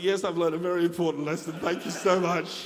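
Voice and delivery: hammed-up obedient agreement; mock contrite. Father answers his twelve-year-old daughter, mock contrite.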